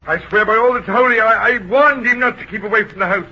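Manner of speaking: agitated self-defence; vehement protest. Acted. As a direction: swear it desperately to the room. Guilty man protests his innocence.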